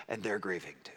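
soft breathy whisper, trailing away. Real, not acted. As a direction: drop to a whisper on the grief. Tender climax of the plea.

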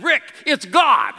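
high, loud, slow excited call. Retold or imitated. Wife calling to her husband over the covered phone, thrilled. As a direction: burst out that God is on the phone.

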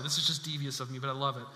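usual speaking voice

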